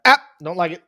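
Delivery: hammed-up dismissive fan; grumpy fan. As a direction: scoff like a put-off fan. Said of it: "nascar fans hear that Australian accent".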